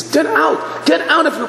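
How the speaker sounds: high-pitched mock scream; acted furious outburst. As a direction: throw him out in a shrieked order. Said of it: "He starts screaming at him".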